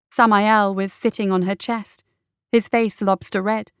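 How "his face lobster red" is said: usual speaking voice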